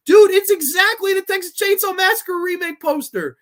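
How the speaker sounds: almost shouting the discovery; incredulous. Real, not acted. Showing viewers the poster, pitched high and loud. Comparing two posters in disbelief.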